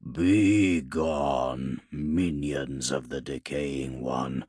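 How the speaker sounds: low rumbling Tree Lord voice; talking tree. Acted. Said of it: "rumbled the Tree Lord".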